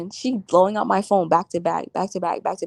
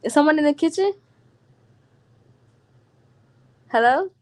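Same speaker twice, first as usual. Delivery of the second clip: higher and louder, uneasy; nervous calling out; 6 semitones higher, 5 dB louder than usual. Real, not acted. Alone at home, nervously calling out toward the kitchen. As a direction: call out scared of an intruder.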